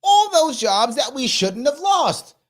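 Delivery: mock-cheery agreeing with the governor in a high voice; sarcastic high agreement. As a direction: fake enthusiasm dripping with sarcasm.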